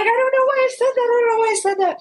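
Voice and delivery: high, loud, fast frantic scream. Retold or imitated. Girl screaming to her friend she can't explain herself. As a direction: scream it in mortified panic. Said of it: "I was screaming".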